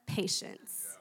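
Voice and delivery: "patience" whispered low, voiceless and falling; whispered kicker. Shown as a demonstration. Preacher whispering "patience" as the verse's final word to the congregation. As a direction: drop to a breathy whisper on "patience". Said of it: "and the kicker".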